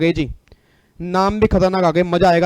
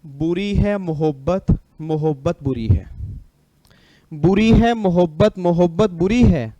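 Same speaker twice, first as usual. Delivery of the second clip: slow, drawn-out poetic recitation; shayari recital; slower than usual. Acted. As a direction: recite the couplet slowly for a round of wah-wah. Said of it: "एक line बोलूंगा बस". (धीमे, शायराना अंदाज़ में)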